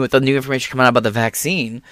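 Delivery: usual speaking voice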